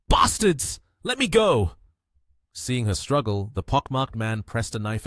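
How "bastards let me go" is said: loud, high-pitched furious cry; terrified fury. A narrator's reading. Captive struggling as thugs grab her, furious shout. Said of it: "both terrified and furious".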